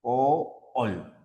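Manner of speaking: "alcohol" split slowly into al, co, hol; syllable by syllable. Shown as a demonstration. Teacher dividing the word for students, "alcohol" syllable by syllable. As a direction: separate each syllable of "alcohol" with pauses. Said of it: "sílaba átona y sílaba tónica".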